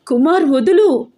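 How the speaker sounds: high, loud cry to let go. A narrator's reading. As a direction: yell at him to release you. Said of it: "నేను మరింత గట్టిగా అరుస్తున్నాను". (మరింత గట్టిగా అరుపు)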